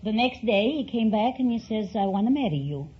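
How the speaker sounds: ordinary talk, nothing marked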